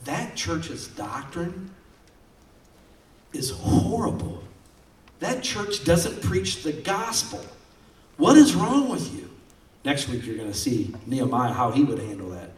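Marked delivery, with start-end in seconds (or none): 0.1-9.3 slow, high, rising to an indignant yell; scandalized pastor cry; mock outrage. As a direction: act out disbelief, building to a shout. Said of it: "I'm just like".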